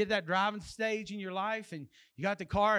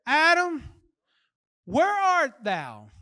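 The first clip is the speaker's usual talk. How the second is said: God calling out; 5 semitones higher, 6 dB louder, slower than usual. Acted. Acts God calling out to Adam.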